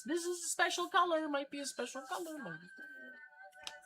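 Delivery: high falsetto. Real, not acted. Admiring the special color vinyl, quiet falsetto coo. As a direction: coo over the record in falsetto.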